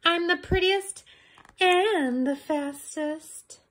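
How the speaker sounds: high, slow, sing-song; vain girl voice. Acted. Red admiring her cape, high and sing-song. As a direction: preen like a vain little girl. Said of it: "she said".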